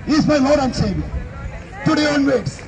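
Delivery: ordinary talk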